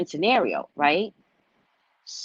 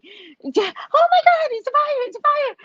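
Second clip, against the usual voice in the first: very high panicked shriek; hammed-up high-pitched alarm; mock screaming; 4 dB louder than usual. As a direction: cry fire like a terrified crowd. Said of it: "everybody's screaming".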